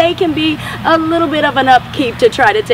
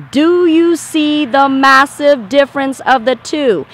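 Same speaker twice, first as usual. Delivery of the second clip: ordinary talk, nothing marked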